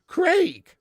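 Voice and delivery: "Craig" high and loud as crayg; British Craig. Shown as a demonstration. Streamer concluding his correction, "Craig" as an emphatic crayg. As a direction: land hard on "Craig", rhyming with vague. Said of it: "the spelling of the name".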